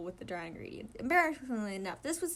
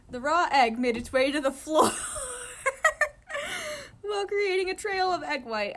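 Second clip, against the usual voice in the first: high, breaking with laughter; laughing; 9 semitones higher, 6 dB louder than usual. Real, not acted. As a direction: read it while cracking up. Reader laughing mid-sentence.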